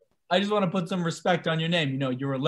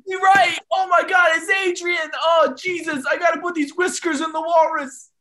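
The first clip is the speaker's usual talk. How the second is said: excited; 8 semitones higher than usual. Acted. Rocky excited Adrian came.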